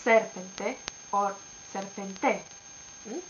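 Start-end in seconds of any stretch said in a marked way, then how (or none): 0.0-0.8 serpente with SER stressed; stress on first syllable. Shown as a demonstration. 1.7-2.4 serpente with final TE stressed; stress on last syllable. Shown as a demonstration.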